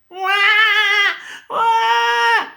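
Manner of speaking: high, loud mock baby wail; imitated baby cry. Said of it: "Adik bayi menangis". (menirukan tangisan bayi yang keras)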